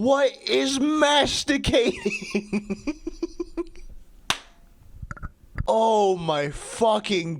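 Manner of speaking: high, slow, breaking into giggles; real laughter. Real, not acted. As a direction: read through helpless laughing. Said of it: "that was a drink for laughing".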